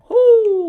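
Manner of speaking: high whooping cry of relief; relieved whoo. Real, not acted. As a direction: let out a loud whoo. Narrow escape in game.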